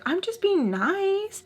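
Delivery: high, drawn-out protest; stretched, high-pitched defensiveness; mock innocent. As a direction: imitate a friend playing innocent. Friend defends herself innocently.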